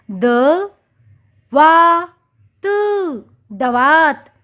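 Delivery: द, long stretched वा, त, then दवात joined; syllable by syllable. Shown as a demonstration. Teacher repeating दवात's syllables. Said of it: "इसलिए हमने वा कहा".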